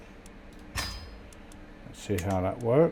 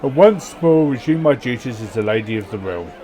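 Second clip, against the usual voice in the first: loud, grand storyteller reading; event narration; 4 semitones higher, 9 dB louder than usual. Acted. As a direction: read the event like a saga. Reads game event text aloud.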